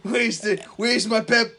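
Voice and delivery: loud, hammed-up Boston accent; exaggerated Boston townie accent. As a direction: holler it in a put-on Boston accent. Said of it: "where does that accent come from?".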